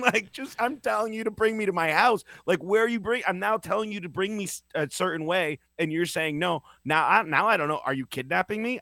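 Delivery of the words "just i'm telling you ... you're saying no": raised pitch, exasperated insistence; mock-indignant, pitched up at the driver; heated retold complaint. Retelling yelling at Uber driver.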